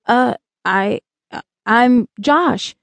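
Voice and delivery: tongue-tied, slow and hesitant; awed, halting mumble. A narrator's reading. Awed boy introducing himself to strange visitors, halting and tongue-tied. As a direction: stumble over your own introduction. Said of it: "he finally was able to mumble".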